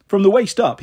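ordinary delivery, nothing marked

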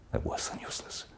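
bitter little whisper. Acted. Psychiatrist dismissing his own questions, whispered. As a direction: let it fall away as a whisper. Private musing monologue.

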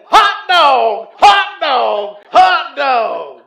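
high, very loud jubilant whoop; full-voiced shout of joy; overjoyed shouting. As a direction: holler it like an overjoyed new convert bursting up. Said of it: "he came up shouting".

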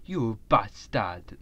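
jokey put-on Rasta accent, slowing at the end; a light, joking Rasta accent; mock Rasta accent. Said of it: "that's gone Rasta now".

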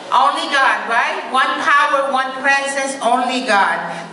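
rally the room with each phrase; call-and-response preaching. Acted. Minister leading the congregation through the first principle, rousing.

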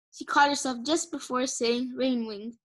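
usual speaking voice

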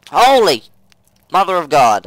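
loud startled cry of holy mother of God; startled shout. Real, not acted. Gamer startled by a spider, loud startled shout. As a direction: blurt it out in real fright.

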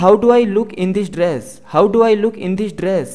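very slow, careful English for learners; slow model sentence. Shown as a demonstration. Teacher reading the example slowly to students. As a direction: spell each word out slowly for the class. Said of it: "teacher model sentence".

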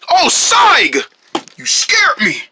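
high, startled complaint; startled cry. Acted. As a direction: cry out after a scare. Startled by friend.

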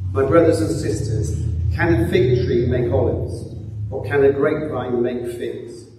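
normal talking voice

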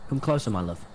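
low, soft hammed-up lure; mock seductive murmur. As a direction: croon it low to tempt the ghost. Luring ghost, then laughs.

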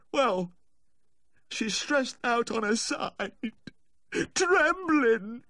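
high, wailing account; anguished, slow and very high-pitched; distraught report. Acted. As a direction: draw the symptoms out in high distress. Owner reports dog's symptoms tearfully.

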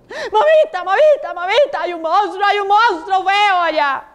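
high, panicky calls that come down a little; frightened chick. Acted. Scared chick telling mamita about the ugly monster, frightened. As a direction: call urgently for mummy in a high little chick voice. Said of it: "asustado ese pobre pollo".

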